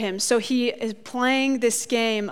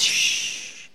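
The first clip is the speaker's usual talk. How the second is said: voiceless hush, breath only; whispered shush; 4 dB quieter than usual. Retold or imitated. Jesus hushing the healed man, a whispered shh. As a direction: hush him like a secret. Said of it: "He turns to him and says".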